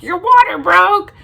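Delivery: jolted awake by the news; startled disbelief. Retold or imitated. Husband hearing her water broke, shocked and loud.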